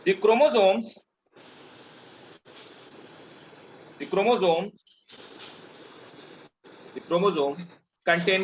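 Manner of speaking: slow, word-by-word dictation voice. Acted. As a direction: dictate each phrase slowly for notes. Teacher dictating notes slowly.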